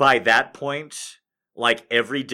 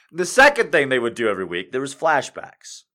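ordinary delivery

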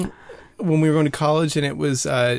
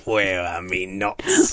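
creepy homeless man; 8 semitones lower, slower than usual. Retold or imitated. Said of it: "He's like".

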